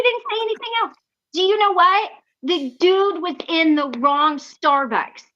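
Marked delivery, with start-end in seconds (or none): none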